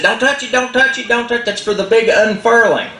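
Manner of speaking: high, coaxing hurried voice; eager Cricket. Acted. Cricket coaxing Possum about the unfurling, high and eager. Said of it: "Cricket said".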